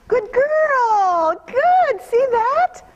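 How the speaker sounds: high, loud, delighted praise; bright praise. Real, not acted. Trainer rewarding the mare's good move, high delighted praise. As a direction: cheer her on like she just nailed it.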